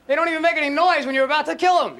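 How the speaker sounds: ordinary delivery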